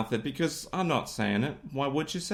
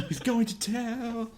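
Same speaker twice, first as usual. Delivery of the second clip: high, drawn-out taunt; mock singsong; 9 semitones higher, 4 dB quieter, slower than usual. Teasing that he's going to tell, high singsong.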